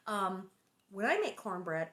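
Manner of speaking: usual speaking voice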